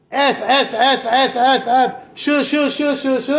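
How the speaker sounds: high, punchy bursts of censored swearing; imitating constant cursing. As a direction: mimic a nonstop stream of swear words. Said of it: "every second word they say".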